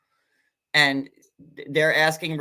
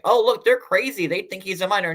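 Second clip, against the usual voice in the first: mock-dramatic, higher and louder; exaggerated mock quote; 6 semitones higher, 4 dB louder than usual. Mocking Rebecca's protest to viewers, exaggerated and high. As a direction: ham up her protest like a drama queen. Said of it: "super exaggerating it".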